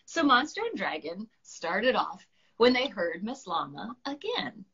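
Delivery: ordinary delivery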